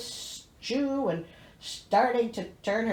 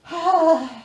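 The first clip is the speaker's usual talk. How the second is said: weary sigh; 5 semitones higher, 7 dB louder than usual. Real, not acted.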